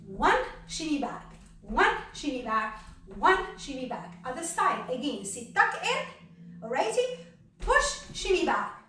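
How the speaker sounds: rhythmic counting cues. Shown as a demonstration. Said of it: "then we're going to push from under here".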